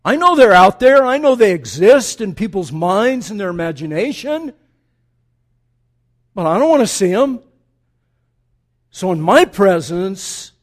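God commanding loudly, no other gods. Acted. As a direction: thunder it down as God forbidding. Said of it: "that God said".

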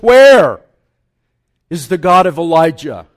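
loud, ringing call to heaven. Acted. Elisha calling to God across the water, loud ringing cry. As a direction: cry it out like a prophet. Prophet strikes water and calls out.

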